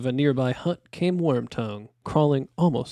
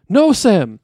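loud, high urgent stop; firm command; 8 semitones higher, 11 dB louder than usual. A narrator's reading. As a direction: halt him with sudden force. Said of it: "said Frodo".

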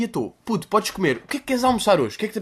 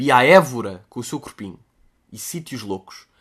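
usual speaking voice

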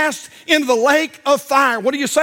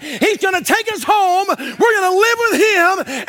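preaching; 7 semitones higher than usual. Acted. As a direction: build it up with fervor. Preaching climax, boy's faith.

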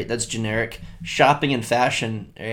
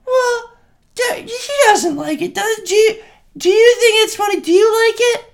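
high-pitched questioning mom; 5 dB louder than usual. Retold or imitated. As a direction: scold like a high-voiced mom. Voicing mom in baby video.

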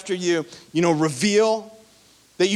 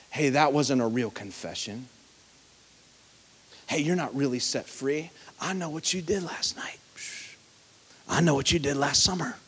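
low, hushed, sly taunting whisper; 4 semitones lower, 6 dB quieter than usual. Retold or imitated. Preacher voicing the enemy whispering to the congregation, sly whisper. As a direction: whisper doubt into their ear like a tempter. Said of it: "the enemy's gonna be whispering in your ear".

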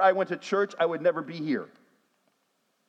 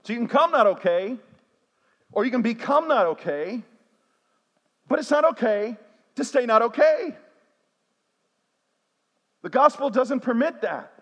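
rising, louder preacher build on each phrase; preaching; 4 semitones higher, 4 dB louder than usual. Acted. Pastor pressing the church, building preaching force. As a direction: drive it home to the congregation.

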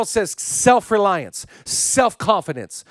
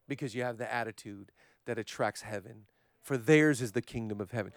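much softer, lower, intimate murmur to God; hushed prayer; 7 semitones lower, 11 dB quieter than usual. Acted. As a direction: pray it under your breath, humbly.

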